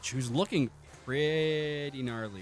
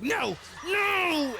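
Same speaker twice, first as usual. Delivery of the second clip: much higher frantic shriek of no, no; panicked witch. Acted. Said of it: "starts to scream".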